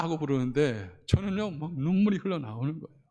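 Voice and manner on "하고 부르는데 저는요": usual speaking voice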